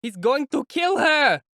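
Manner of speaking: high, loud, slow horrified shout; alarmed shout. A narrator's reading. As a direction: cry out in horror from the crowd. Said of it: "the shout went round".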